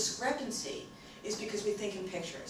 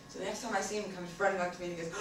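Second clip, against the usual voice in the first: ordinary delivery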